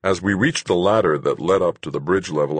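ordinary delivery

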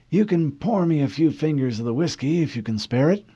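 put-on high, kindly old admiral. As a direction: wave him down like a grandfather officer.

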